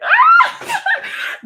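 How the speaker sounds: a sudden high shrieking laugh; loud burst of laughter. Real, not acted. Reading a viewer's comment about her accent, bursting out laughing. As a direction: crack up loudly at the comment.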